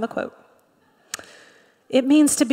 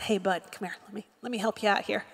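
much softer, casual aside; soft reassurance; 3 semitones lower, 11 dB quieter, faster than usual. Acted. Preacher imagining Jesus helping Peter out, soft friendly murmur. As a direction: say it low-key, like helping a pal up.

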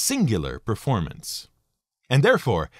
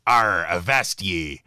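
low, slow West Country pirate growl, hammed up; West Country pirate accent; 7 semitones lower, slower than usual. Narrator imitating screen pirates, in a low West Country accent. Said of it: "is the West Country accent brought to the screen".

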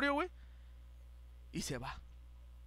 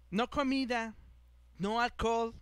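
measured, staccato mock officer; officer's broken Spanish; 2 semitones higher, slower than usual. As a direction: list the bans word by word, like an official. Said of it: "nos dice en inglés". (imitando al oficial, palabra por palabra)

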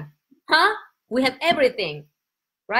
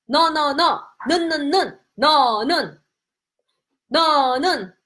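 ordinary talk, nothing marked

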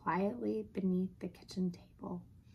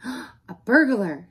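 loud, drawn-out, raised exclamation; alarmed gasp; 5 semitones higher, 12 dB louder, slower than usual. Acted. Dog hears glass breaking, gasping in alarm. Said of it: "she gasped".

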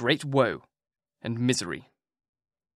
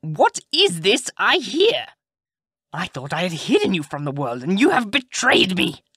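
angry witch; 5 semitones higher, 5 dB louder than usual. A narrator's reading. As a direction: scold her like a betrayed witch. Witch confronts Rapunzel.

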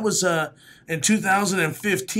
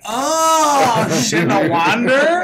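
higher, louder stunned outburst breaking into a laugh; amazed parents; 7 semitones higher, 6 dB louder, much slower than usual. Retold or imitated. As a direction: imitate the parents finally getting it. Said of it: "Then they're like".

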